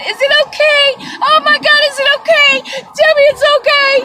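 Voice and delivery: very high, loud begging; frantic excited pleading. Retold or imitated. On the couch asking partner, frantic high pleading. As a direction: beg for reassurance, squealing. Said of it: "I was saying".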